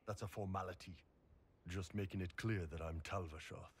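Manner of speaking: ordinary delivery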